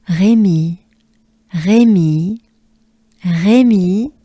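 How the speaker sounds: "Rémy" with French R, final ee held; emphatic French Rémy for repeating; French name demo. Shown as a demonstration. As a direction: model Rémy with a long smiling final vowel. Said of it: "good breath with me".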